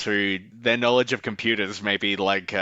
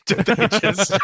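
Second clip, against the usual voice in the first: high, loud burst of laughter; cracking up mid-question; laughing; 12 semitones higher, 4 dB louder, slower than usual. Real, not acted. Laughing at own joke.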